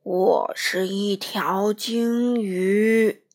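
low, loud, slow whale boom; deep whale voice. Acted. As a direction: rumble it like a whale. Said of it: "那声音回答".

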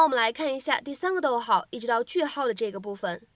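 normal talking voice

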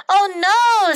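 high, loud oh no; dismayed cry. Acted. Dexter opening his lunch, dismayed and loud.

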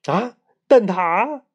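slow, high, drawn-out gasp of interest; surprised delight. Acted. Boy suddenly hearing about egg tarts, delighted surprise. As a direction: perk up at the word of treats.

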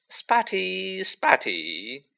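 high first call, then dropping; mild yell. A narrator's reading. Poltergeist chases the sobbing ghost, mildly yelled taunt. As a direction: jeer it as a restrained yell.

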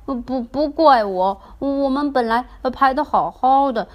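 anxious toy protesting innocence; flustered toy. Acted. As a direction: splutter out the excuse. Toy defending itself in quarrel.

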